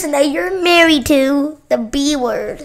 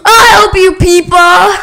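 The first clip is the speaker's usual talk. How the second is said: higher, loud mock-heroic call; heroic rescue cry; 5 semitones higher, 6 dB louder than usual. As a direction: shout it like a hammed-up hero. Rushing to rescue Peppa.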